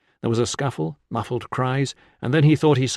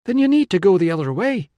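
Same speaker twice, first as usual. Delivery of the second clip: firm call; 8 semitones higher, 6 dB louder than usual. A narrator's reading. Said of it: "she told him".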